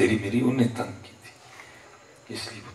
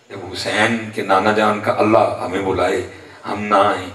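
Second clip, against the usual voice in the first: loud sermon rebuke; forceful preaching; 9 dB louder than usual. Acted. Preacher rebuking those who skip prayer, forceful preaching. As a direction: drive each question home loudly.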